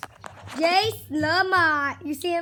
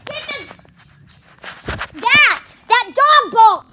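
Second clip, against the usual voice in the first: higher, louder urgent shout; urgent yelling; 9 semitones higher, 9 dB louder than usual. Real, not acted. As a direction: hurry him with a yell. Mom yelling urgently at son.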